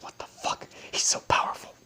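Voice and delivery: hushed, whispered amazement; awed whisper. Retold or imitated. People watching him suplex everybody, awed mock whisper. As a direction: whisper it like a stunned onlooker.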